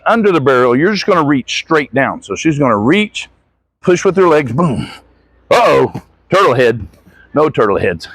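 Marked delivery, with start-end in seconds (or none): none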